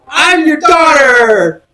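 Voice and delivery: high, slow, crying shout; dramatic shouted reveal. Retold or imitated. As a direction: wail it out like a soap opera reveal.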